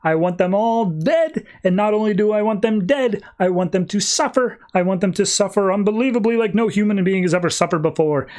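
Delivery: a mild retold yell of wanting them dead; mock angry yelling. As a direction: play the vengeful villain demanding blood, lightly. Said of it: "just yelling at the Five".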